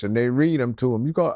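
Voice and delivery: ordinary talk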